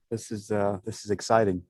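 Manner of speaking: ordinary delivery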